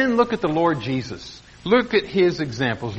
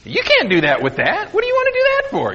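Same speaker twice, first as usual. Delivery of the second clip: rushed, squeaky mocking sneer, far higher; snotty rude clerk. Preacher mimicking the rude music-store man, sneering and fast. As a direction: mimic the salesman's scorn, very fast. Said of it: "just being very rude and very snotty".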